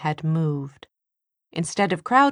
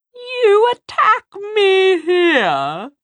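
very high, slow, shrill demon shriek; high thin demon voice; 9 dB louder, much slower than usual. A narrator's reading. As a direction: screech it out like a demon. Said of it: "it demanded in a high, thin voice".

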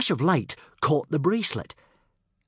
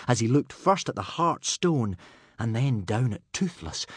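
plain reading voice

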